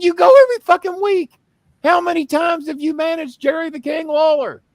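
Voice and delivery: very high, loud incredulous yelling; screaming in disbelief at mom; retold furious yell. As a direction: blow up at your mother.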